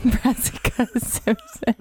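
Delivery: slow, laughing through the name; giggling as she repeats it. Real, not acted.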